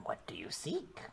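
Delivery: unvoiced witch whisper; whispering crone. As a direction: whisper it like a sly hag. Hag asks, whispered.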